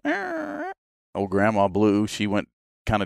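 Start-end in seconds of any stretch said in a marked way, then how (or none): 0.0-0.7 imitating a dog's high whimper; high dog whine. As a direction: mimic a whiny hound yelp. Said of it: "He goes".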